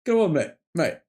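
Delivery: hammed-up Australian "come on, mate"; mock Aussie mate. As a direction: play the matey Aussie, pitch up. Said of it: "It's normal to say, "Mate".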